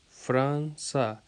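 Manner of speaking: فرانسا slowly split as Fran... sa; every sound voiced. Shown as a demonstration. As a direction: draw out فرانسا in two parts, Fran and sa. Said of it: "سارے لفظ جو ہیں، ان کی آواز".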